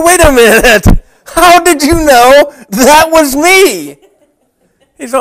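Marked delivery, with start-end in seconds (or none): 0.0-4.0 amazed disbelief. Retold or imitated. Amazed he was recognized.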